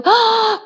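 a high longing "ah!" sigh; wistful sigh. Retold or imitated. People dreaming of the future let out a high sigh.

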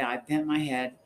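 plain reading voice

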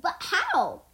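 high, loud, incredulous question; shouted question. A narrator's reading. Lloyd confronts Lord Garmadon, shouted question. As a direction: demand an answer loudly. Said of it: "Lloyd shouted".

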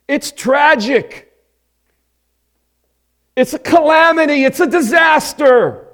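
rising to a loud, high cry over the disaster; rising lament. Real, not acted. Preacher lamenting damnation loudly.

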